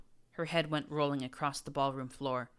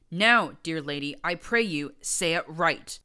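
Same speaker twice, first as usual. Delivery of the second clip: menacing politeness; 9 dB louder than usual. A narrator's reading. Menacing courtly demand.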